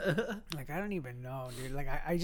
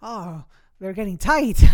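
mock aroused kid; 4 semitones higher, 11 dB louder than usual. Continuing the mock kid bit.

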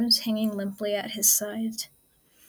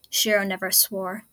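plain reading voice

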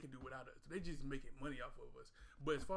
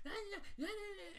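high squeaky mock yeah yeah yeah, much higher. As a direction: mimic a loud rapper brushing someone off in a high voice. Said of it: "when she's arguing 'cause she's loud".

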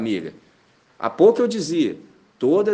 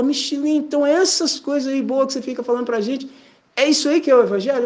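higher, eager questioning voice; curious friend; 8 semitones higher, faster than usual. Retold or imitated. Friend asking Michelin if the good things he says are the gospel, eager. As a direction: ask like a friend finally getting it.

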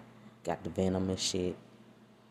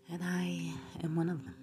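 whisper; 9 semitones higher, 5 dB quieter than usual. Real, not acted. Playful whispered confession.

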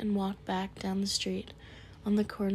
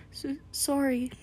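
sobbing; 6 semitones higher than usual. Acted. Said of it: "sobbed Hagrid".

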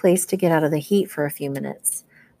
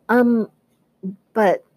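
stammering; 5 semitones higher than usual. Acted. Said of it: "I stammered".

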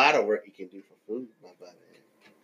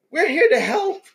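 high falsetto helper; high sweet mock helpful voice; 14 semitones higher than usual. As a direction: chirp it sweetly. IRS agent pose.

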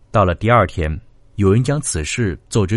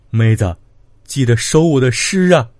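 raised, pleading last words; tearful cry; 4 semitones higher, 4 dB louder than usual. A narrator's reading. Condemned brother calling to his sister before execution, tearful cry. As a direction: call out loudly through tears. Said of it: "抬头洒泪道".

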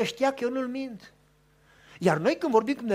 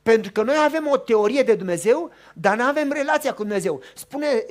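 ordinary talk

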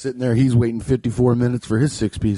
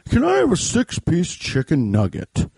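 put-on slightly raised old-timer voice; old man voice; 4 semitones higher than usual. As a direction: order like an elderly man. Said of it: "That's an old man voice".

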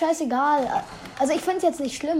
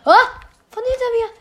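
surprised; 8 semitones higher, 6 dB louder than usual. Real, not acted. Surprised shot from behind.

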